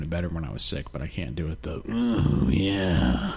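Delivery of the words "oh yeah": power pervert voice. Shown as a demonstration. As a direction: push the creepy pervert voice and lose it. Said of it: "That sounds pretty close".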